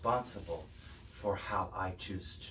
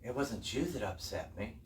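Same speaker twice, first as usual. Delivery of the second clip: ordinary talk, nothing marked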